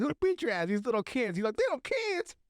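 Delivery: very high-pitched disbelief; hammed-up squeal of protest; mock incredulous exchange. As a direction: act out an indignant back-and-forth. Acts heated exchange about little kids.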